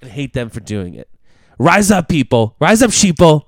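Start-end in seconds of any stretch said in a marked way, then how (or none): 1.6-3.4 loud, rallying rabble-rouser cry; fiery revolutionary speech. Pretend.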